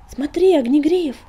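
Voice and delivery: loud high call. A narrator's reading. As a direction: shout to get his attention. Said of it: "окрикнула песчаная буря". (громкий окрик)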